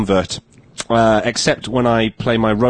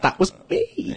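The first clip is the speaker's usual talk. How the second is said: laughing admission; 7 dB quieter, slower than usual. Real, not acted.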